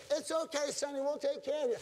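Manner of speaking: high little-lady voice; little old lady. Acted. Little lady at the hospital soothes the husband, imitated high voice. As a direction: put on a small reassuring lady. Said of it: "And she goes".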